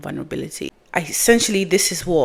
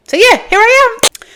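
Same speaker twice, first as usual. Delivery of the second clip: excited announcement; 11 dB louder than usual. Real, not acted. Announcing herself.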